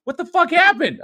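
high, loud shocked outburst; shocked yell. Retold or imitated. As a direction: yell it like a stunned wife. Wife walks in on wrecked house.

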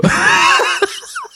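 high laughing fit; laughter. Real, not acted. S0 laughs at own seks uger answer, laughing. Laughs after saying six weeks.

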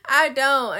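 loud, emphatic denial. Real, not acted. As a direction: say it loud and firm.

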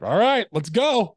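high, loud, slow rally cry; pumped up. Retold or imitated. Answering her inner boss voice, pumped up. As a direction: psych yourself up to run. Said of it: "I'm just like".

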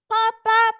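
high, loud toy-car horn; little car agreeing. A narrator's reading. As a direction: toot cheerily like a little car. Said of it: "said his little car in agreement".

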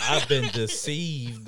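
slow, drawn-out laughing mock lament. Cook joking he was deceived, drawn-out laughing. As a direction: stretch it out through a laugh.

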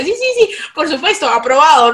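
high, loud rapid yeses; eager fake agreement. Nodding along to the Manchester classmate, eager fake agreement. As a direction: nod along, pretending you follow. Yo aceptaba todo lo que le decía.

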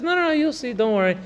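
high, breezy brush-off; mock dismissive. Teacher imitates an offender brushing off his hurt friend, high and breezy. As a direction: wave off the friend with a careless "you'll see".

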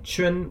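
Chun held level and high, slow; Chun in first tone. Shown as a demonstration. Host teaching listeners: Chun on a flat high tone. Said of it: "C U H N in the first tone".

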